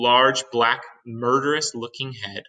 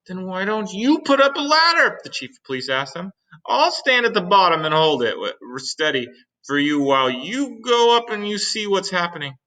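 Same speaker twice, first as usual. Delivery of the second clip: high police chief voice; 9 semitones higher, 4 dB louder than usual. Acted. Chief suggests ladder.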